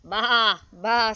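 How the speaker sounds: loud storybook baas; sheep bleating. A narrator's reading. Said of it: "said Sheep".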